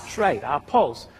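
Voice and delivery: normal talking voice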